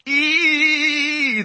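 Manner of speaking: high sharp cry of pain; high yelping cry. A narrator's reading. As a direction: yelp as the whiskey hits. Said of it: "he cried".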